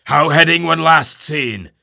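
a loud high hail upward; calling aloft. Acted. Ahab calls up to the lookout, loud hail. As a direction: shout the question up the mast.

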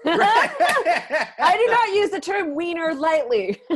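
high, loud words through laughter; laughing. Real, not acted. Woman struggling to say wiener with a straight face, laughing. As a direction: protest while cracking up, drawn out. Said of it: "can't say wiener without laughing".

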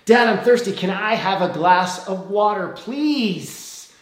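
put-on a little boy begging from bed for water; whiny child calling. Son calls to dad for water, high and whiny.

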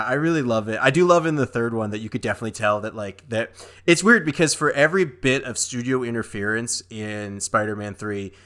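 usual speaking voice

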